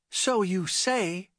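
a touch of skeptical curtness; curt. A narrator's reading. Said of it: "Becky responded curtly".

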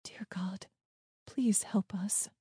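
very soft, near-whispered plea; hushed prayer. A narrator's reading. Child hiding from the gunman, hushed prayer. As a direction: pray under your breath.

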